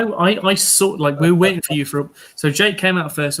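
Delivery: ordinary talk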